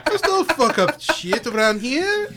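slow, high mock Italian tough guy; mock Italian accent. Joking about Italians, he hams a slow mock Italian tough guy. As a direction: lay it on thick like a cartoon wiseguy. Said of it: "Or Italian".

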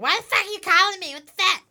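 mock indignant outburst; pissed-off mom. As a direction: snap like a mother annoyed by a call. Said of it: "She'd get really pissed off".

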